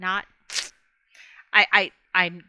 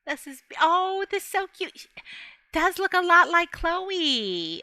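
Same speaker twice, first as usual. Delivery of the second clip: cooing over cat; 9 semitones higher than usual. Real, not acted. Gushing over cat picture.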